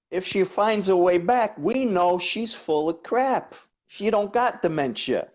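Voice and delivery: high, loud, swaggering New York Italian tough guy; hammed-up Bronx Italian tough talk. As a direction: play the Bronx wiseguy joking about ditching mom. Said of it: "I go".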